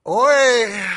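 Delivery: Oi! barked loud and high; Hebrew oi. Shown as a demonstration. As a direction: cry Oi! as a sharp, loud lament. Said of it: "what it sounds like in the Hebrew".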